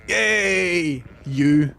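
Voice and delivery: high, loud cheer as the lamb; lamb's joyful yay. Retold or imitated. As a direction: cheer like a lamb finding its mum. Voicing the lamb finding mum.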